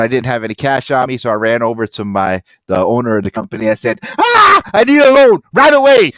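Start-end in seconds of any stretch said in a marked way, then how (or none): 4.2-6.1 loud, high-pitched mock urgency; hammed-up panicky demand; frantic plea. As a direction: blurt it out as an over-the-top cry for help. Frantic plea for money retold.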